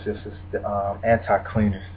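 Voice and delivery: a lightly put-on creepy whisper-soft tone; creepy scary voice. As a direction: drop low and quiet like a spooky villain. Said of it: "gotta use my creepy, scary voice".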